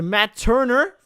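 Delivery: name put on in an American accent. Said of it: "saying it in that accent".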